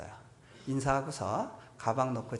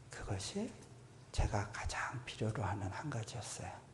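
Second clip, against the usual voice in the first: 속삭이듯 작고 느리게; 숨죽여 진심을 털어놓듯; 조용한 고백; 2 semitones lower, 9 dB quieter than usual. Real, not acted. 설교 절정의 고백. (회중에게 고백하는 설교자, 속삭이듯)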